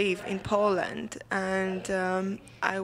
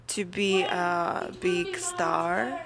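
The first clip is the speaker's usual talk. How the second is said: normal talking voice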